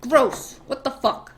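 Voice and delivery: mild mock outrage; king's put-on revulsion; acted angry disgust. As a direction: recoil in half-serious disgust. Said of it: "like, super angry".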